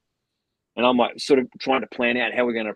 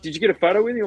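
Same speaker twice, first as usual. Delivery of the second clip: excited; 8 semitones higher, 4 dB louder, much faster than usual. Retold or imitated. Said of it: "He's like".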